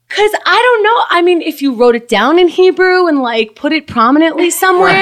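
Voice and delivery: high-pitched, put-upon protest; mock exasperation. Customer answering back at the restaurant register, mock exasperated. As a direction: pitch it way up, playfully indignant.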